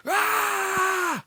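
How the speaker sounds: high mimicked shriek; exaggerated high-pitched retold scream; mock scream. Mimics the screaming dog-walker.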